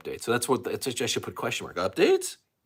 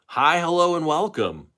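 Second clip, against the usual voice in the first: higher, louder broadcaster-style hello; George Grand greeting; 7 semitones higher, 5 dB louder than usual. Retold or imitated. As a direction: greet like an old TV host. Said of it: "Isn't that what he said?".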